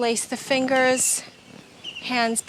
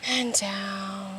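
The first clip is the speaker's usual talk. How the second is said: breathy whispered cue; whispering; 3 semitones lower, 9 dB quieter, much slower than usual. Real, not acted. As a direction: whisper the word down. Whispered cues during breathing practice.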